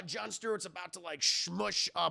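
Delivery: usual speaking voice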